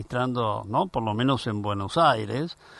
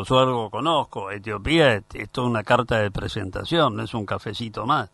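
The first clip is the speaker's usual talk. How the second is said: normal talking voice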